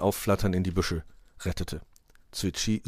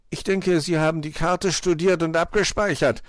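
high, slightly louder fox voice; pitched-up doubtful fox; doubting fox; 9 semitones higher, 5 dB louder than usual. A narrator's reading. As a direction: question her sceptically. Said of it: "zweifelte Herr Fuchs".

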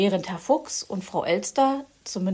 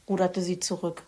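usual speaking voice

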